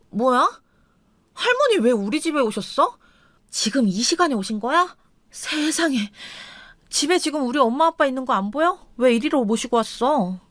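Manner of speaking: lightly voiced wife, put out and questioning; displeased wife. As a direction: play a wife taken aback by an unwelcome guest.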